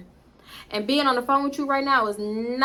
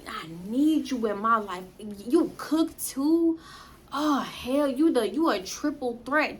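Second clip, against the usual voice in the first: mocking his flattery; slower than usual. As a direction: stretch it out like a cheesy guy laying it on.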